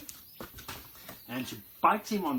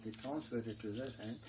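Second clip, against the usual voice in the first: ordinary talk, nothing marked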